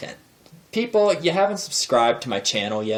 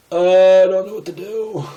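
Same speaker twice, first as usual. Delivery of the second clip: loud hesitant uh, then a lost, higher mutter; awkward helplessness; 7 semitones higher, 6 dB louder than usual. Retold or imitated. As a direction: play the clueless guy who can't handle tears. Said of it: "and I'm like".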